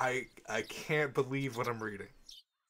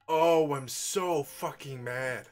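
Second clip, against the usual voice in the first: slow, louder stretched complaint; drawn-out frustration; 3 semitones higher, 7 dB louder, much slower than usual. Real, not acted. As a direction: stretch every word out, fed up. Frustrated at game.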